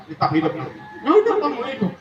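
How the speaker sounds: usual speaking voice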